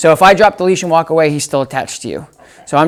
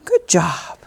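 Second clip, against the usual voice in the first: soft whispered praise; whispering; 6 dB quieter than usual. Real, not acted. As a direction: barely voice it.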